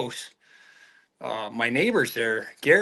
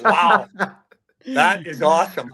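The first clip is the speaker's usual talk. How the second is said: louder, amazed retold wow; delighted wow; 3 semitones higher, 6 dB louder than usual. Storyteller reacting to the drunk fan on the bus, loud amazed wow. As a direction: marvel at it out loud. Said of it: "and I'm like".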